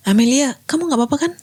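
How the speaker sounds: high, soft concerned question; worried check-in. Acted. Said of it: "untuk memastikan Amelia baik-baik saja". (bertanya cemas, lembut dan tinggi)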